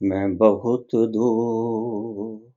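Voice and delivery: very slow, soft, stretched-out vowels; long drawn-out words. Real, not acted. Guru telling disciples he is beyond all reach, slow and drawn out. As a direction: let बहुत and दूर trail out long and quiet. Guru drawing out his remoteness.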